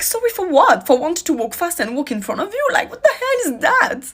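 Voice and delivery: high-pitched, disbelieving comeback; indignant retort. Retold or imitated. Retelling refusal to apologise to man on street, indignant. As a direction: retell an argument, pitch leaping in outrage.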